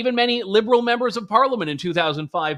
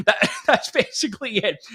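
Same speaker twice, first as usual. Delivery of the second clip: chuckling through the words; laughing lightly; 5 semitones higher than usual. Real, not acted. Laughs at own joke.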